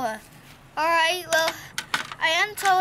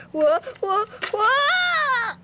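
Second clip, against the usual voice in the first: rising loud alarmed whoas; startled whoa; 10 semitones higher, 6 dB louder, much slower than usual. Pretend. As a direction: cry out while losing balance. Toy slipping and tipping.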